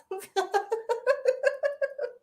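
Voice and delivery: high, long run of giggles; real giggling fit. Real, not acted. Tickled by own joke about subtitles, high giggling. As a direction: dissolve into high rapid laughter.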